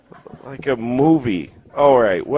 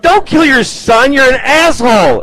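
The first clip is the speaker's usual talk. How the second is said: high, loud, emphatic rebuke; God's scolding shout; 9 semitones higher, 5 dB louder than usual. Acted. God telling off Abraham, exasperated shout. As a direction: bellow the order in exasperation. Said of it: "And he's like".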